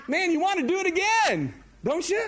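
high, bright-eyed push to the crowd; eager. Real, not acted. A preacher urges the congregation, high and eager.

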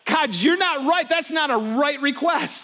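Jonah arguing back at God; indignant Jonah. Retold or imitated. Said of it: "Jonah was like".

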